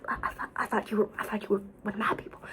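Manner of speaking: fast disappointed whisper. Retold or imitated. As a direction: whisper your letdown quickly.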